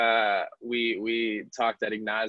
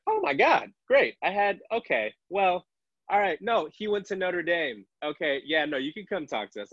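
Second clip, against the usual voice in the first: high, gushing, suddenly impressed voice; imitating someone suddenly won over by Notre Dame; mock gushing relief; 8 semitones higher than usual. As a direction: flip from wary to delighted over a college name.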